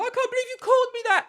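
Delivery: high, fast, loud wail; mock tearful outburst. Daughter she isn't, crumbling at the insult, mock wail. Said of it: "She didn't cry. She didn't go".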